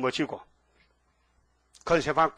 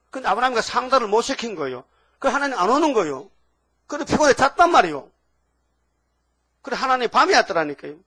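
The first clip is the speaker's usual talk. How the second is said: louder, driving sermon voice; fiery preaching; 5 semitones higher, 5 dB louder than usual. Acted. Pastor urging the congregation about true worship, fiery preaching. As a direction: hammer the point home to the pews.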